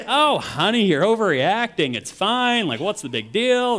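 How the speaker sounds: hammed-up easygoing husband; breezy dismissive. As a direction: brush off her worry, playfully. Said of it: "I'm like".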